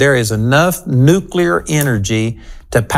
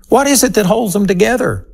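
rhetorical question; 7 semitones higher, faster than usual. Real, not acted.

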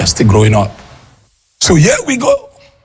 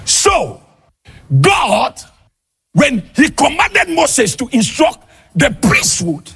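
fiery preaching; 8 semitones higher than usual. Acted. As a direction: preach it hard, half shouting to the congregation. Preaching at full force.

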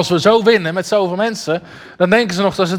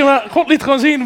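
usual speaking voice

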